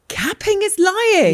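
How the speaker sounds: high, drawn-out incredulous cry; mock disbelief. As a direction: exclaim it as a scandalised discovery. Listener mock-outraged at slang.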